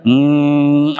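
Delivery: a steady hum on m while breathing out; humming m. Shown as a demonstration. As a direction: hum the m out through the nose, lips closed. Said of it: "ऐसे exhale भी करते रहना है". (म की गुनगुनाहट, साँस छोड़ते हुए)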